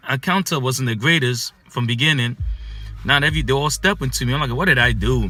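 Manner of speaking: usual speaking voice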